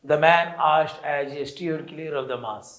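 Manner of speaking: slow, deliberate read-aloud for a class; slow story reading. Acted. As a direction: read each English phrase very slowly and clearly. Teacher reads story text aloud. (धीमी गति से कहानी पढ़ना)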